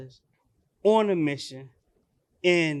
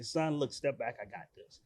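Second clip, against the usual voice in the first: low, soft quoted aside; calm intervention; 7 semitones lower, 9 dB quieter than usual. Retold or imitated. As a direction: take over quietly, pitch dropped. Voices himself taking over calmly.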